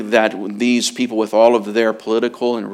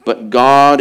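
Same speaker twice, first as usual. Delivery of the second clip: ordinary talk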